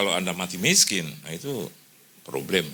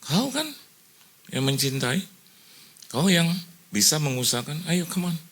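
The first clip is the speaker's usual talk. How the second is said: lightly voiced God urging, higher; a hint of God's voice nudging him on; higher, eager urging as God's voice; voicing God; 7 semitones higher than usual. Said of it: "Tuhan bilang sama saya".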